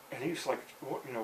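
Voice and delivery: ordinary talk, nothing marked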